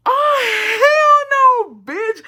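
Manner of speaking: very high, loud, drawn-out outburst; outraged shriek. Retold or imitated. Retelling angry reaction to being mocked.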